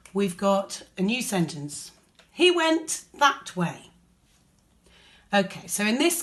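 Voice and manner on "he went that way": higher, slow storytelling read of the line; acted quote. Shown as a demonstration. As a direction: read Mike's words like a character pointing the way. Teacher reads Mike's quoted line.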